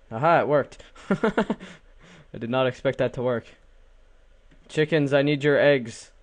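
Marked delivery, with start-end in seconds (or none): 4.7-6.1 loud, raised call to the chickens; weird-sounding call. Pretend. Player demanding eggs from game chickens, weird loud call. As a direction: call out oddly, higher and louder. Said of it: "It sounded so weird".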